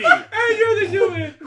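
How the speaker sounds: high and laughing. Real, not acted. Friend points at player through laughter, laughing. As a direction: say it mid-giggle.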